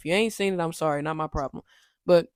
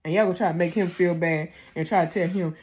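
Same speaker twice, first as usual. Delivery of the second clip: ordinary delivery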